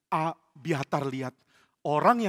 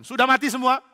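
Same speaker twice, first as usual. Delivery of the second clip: loud, high, barked question; demanding king; 9 semitones higher, 9 dB louder than usual. Acted. Saul questioning his soldiers about the killing, demanding. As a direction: demand an answer at full voice.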